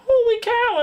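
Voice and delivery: a big delighted squeal of surprise; surprised exclamation. Real, not acted. Streamer noticing Brian arrived, surprised high exclamation.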